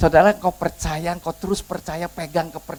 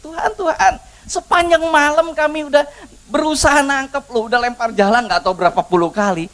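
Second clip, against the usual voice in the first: pleading protest; 11 semitones higher, 4 dB louder than usual. Retold or imitated. Petrus tells Tuhan of a fruitless night, pleading protest. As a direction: protest as a tired, baffled fisherman.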